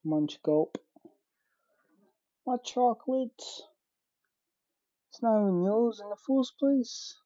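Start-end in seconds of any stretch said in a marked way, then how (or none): 2.5-7.1 higher, squabbling. Acted. Arguing who owns the chocolate, higher. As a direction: snap back in a higher character voice.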